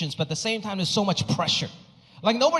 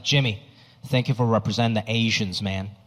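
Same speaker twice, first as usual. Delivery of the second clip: much lower, earnest fan voice; proud fan voice; 10 semitones lower than usual. Retold or imitated. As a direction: play a sincere proud fan, voice dropped low.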